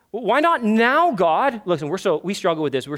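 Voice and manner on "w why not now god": high, louder protest to God; impatient plea. Acted. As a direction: act out an impatient complaint. Voicing impatient believers questioning God.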